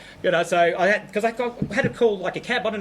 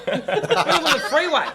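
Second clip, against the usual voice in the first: laughing through the words, higher; cracking up at his own story; 7 semitones higher, 4 dB louder, slower than usual. Real, not acted. Comic telling his bus mishap, laughing.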